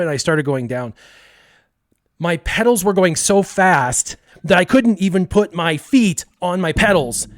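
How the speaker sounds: ordinary delivery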